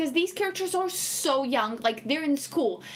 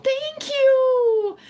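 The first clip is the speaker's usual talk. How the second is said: high, loud, drawn-out thank you; a loud, high-pitched thank you; emphatic thanks; 9 semitones higher, 6 dB louder than usual. Real, not acted. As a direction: thank them with relief. Grateful reply to chat.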